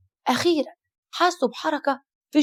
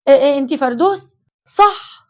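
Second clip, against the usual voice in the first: stammering fear; 8 dB louder, slower than usual. Acted. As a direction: stutter in terror at the ghostly daughter. Shocked mother stammers. (تأتأة مذعورة وعالية)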